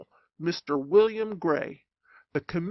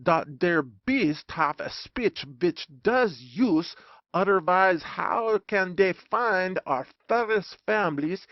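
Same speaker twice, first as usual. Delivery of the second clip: put-on slow stage German accent, d for th; German professor lecturing in a thick German accent; 2 semitones higher than usual. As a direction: play the German professor in a heavy accent, slowly. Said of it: "a German gentleman with thistles in his conversation".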